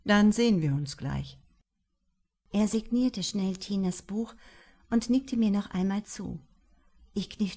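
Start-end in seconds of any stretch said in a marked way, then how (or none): 0.0-1.4 lower, easy male author voice; lightly voiced composed author; calm author. A narrator's reading. Author offers the fan an interview, lower and calm.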